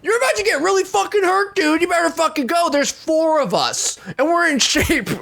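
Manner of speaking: high, full-throated retold shout; angry outburst. Done being nice to the guy, angry shouted threats. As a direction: lose all patience and yell threats up high. Retells losing patience and yelling.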